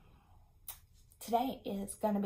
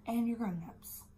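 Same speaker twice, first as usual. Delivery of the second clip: normal talking voice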